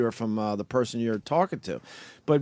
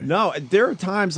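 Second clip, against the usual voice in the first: ordinary talk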